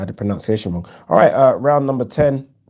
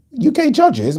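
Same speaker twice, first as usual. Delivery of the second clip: "U K judges?" leaping up high in disbelief; high, disbelieving echo; incredulous question; 3 dB louder, slower than usual. Real, not acted. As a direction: echo it back, stunned it's even said. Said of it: "incredulous echo of a comment".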